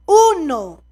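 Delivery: ordinary delivery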